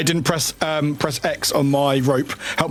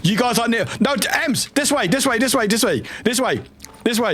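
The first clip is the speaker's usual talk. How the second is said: high urgent directing; hurried panicky calls to a teammate; being chased; 7 semitones higher than usual. Real, not acted. Frantically guiding teammate in danger.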